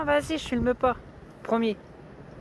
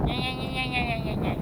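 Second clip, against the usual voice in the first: childish mocking singsong; mocking gna gna; 3 dB quieter than usual. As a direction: taunt like a sulky kid. Mocking after being told off.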